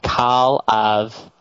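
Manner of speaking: Midwestern drawl on Call of Duty; Midwestern accent. Shown as a demonstration. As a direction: say it with flat Midwestern vowels. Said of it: "you have to pronounce Call of Duty with a, a Midwestern accent".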